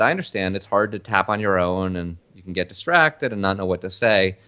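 ordinary talk, nothing marked

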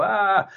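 high drawn-out mock "aah"; haunted-house scream. Imitating a haunted-house visitor's reaction, mock scream. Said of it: "Halloween haunted house that makes you go".

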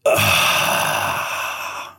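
long audible breath; one slow cleansing breath, drawn out; breathing demonstration. Shown as a demonstration. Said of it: "pull it all the way in through our nose".

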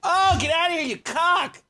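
yelling at bee. Real, not acted. Swatting at the bee.

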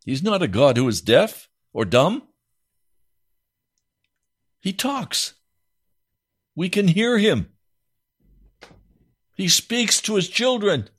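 high, fervent proclamation; rising preaching. Acted. Preacher proclaiming to the congregation. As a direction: lift it up to a climax.

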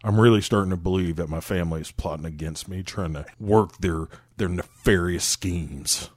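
put-on low drawling old mechanic; old Southern man voice. Imitating Duvall talking to car.

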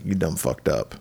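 ordinary talk

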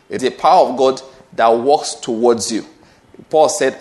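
ordinary talk

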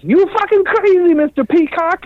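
very high mock-outraged cry from the master; Dario impression. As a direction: shriek it out in gleeful disbelief. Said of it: "he turns around and he goes".

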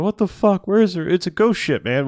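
high, loud bewildered cry; a spooked crew member blurting out; confused shock. Retold or imitated. Crew member wandering the ship, blurting in bewildered shock.

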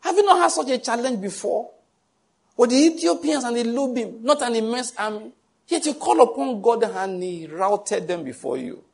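a preacher voicing a prophet scolding King Asa; rebuking prophet. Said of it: "the prophet Hanani came and said".

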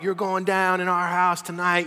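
high, loud group taunt; playful trash talk. Retold or imitated. Friends taunting rivals before a game, rowdy trash talk. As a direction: call out a boast before the game.

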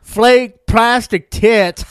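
"[name]" stretched out slowly and loudly, high; mispronounced title. Retold or imitated. Cashier at Taco Bravo mispronounces the album title, slow and loud. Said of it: "she pronounced the name of the song wrong".

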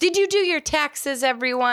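high-pitched call to everyone; calling out to audience. Real, not acted. Co-host asks the listeners about taxes, high call-out.

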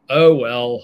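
loud huffy sulk. Acted. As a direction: huff it out sulking. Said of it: "huffed Adrenaline".

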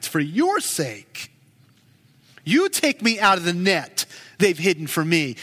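usual speaking voice

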